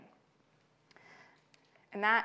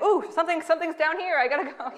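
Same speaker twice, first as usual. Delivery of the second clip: higher, louder flustered excuse; evasive clerk; 8 semitones higher, 8 dB louder than usual. Retold or imitated. Clerk dodging the author at Bevmo, flustered. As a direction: duck out of the conversation fast. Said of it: "he's always like".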